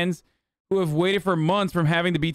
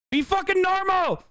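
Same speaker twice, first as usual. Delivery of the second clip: exasperated high cry; 14 semitones higher than usual. Real, not acted. Exasperated outburst at the viewers.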